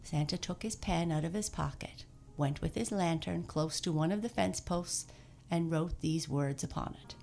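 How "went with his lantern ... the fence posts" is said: ordinary delivery, nothing marked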